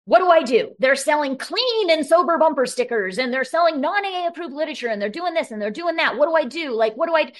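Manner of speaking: hammed-up upset caller, racing through the list; retold fuming panic. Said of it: "he's fuming".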